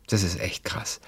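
whispered aside; whispering. Real, not acted. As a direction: whisper it to yourself. Aside while reading comments. (geflüstert)

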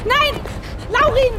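high, pleading cry of his name; desperate shout. Acted. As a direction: scream after him as he leaves. Brother walks off with gun.

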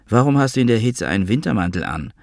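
ordinary delivery

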